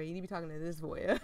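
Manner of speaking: fast, giggling through it; laughing. Real, not acted. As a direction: laugh while you insist. Teasing the player's choice.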